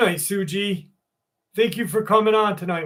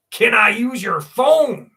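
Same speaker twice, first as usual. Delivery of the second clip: high, loud, drawn-out mock request; 5 semitones higher, 7 dB louder than usual. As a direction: slowly call it out high, mimicking the stranger.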